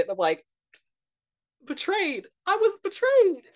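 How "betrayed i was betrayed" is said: high, theatrical wounded outcry; hammed-up betrayal. Son reacting to mother's prank of watching without him, high hammed-up betrayed outcry. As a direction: climb high in melodramatic hurt. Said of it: "such utter betrayal".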